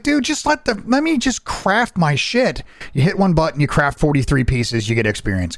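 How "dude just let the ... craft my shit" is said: high-pitched exasperated plea; mock exasperation. Gamer fed up with crafting busywork, high exasperated plea. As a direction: plead in mock frustration, pitched way up. Acts out frustration with grindy games.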